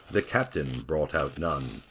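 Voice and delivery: usual speaking voice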